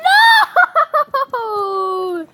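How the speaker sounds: high wavering shout; long, broken-up cry of no; wailed protest. Real, not acted. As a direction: wail it long as you lose. Losing in game.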